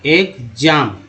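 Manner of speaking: Exam slowly split as ex-am; syllable by syllable. Shown as a demonstration. Teacher showing the EX part, exam slow and divided. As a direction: separate the two parts of exam. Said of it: "EX को x बोलते हैं".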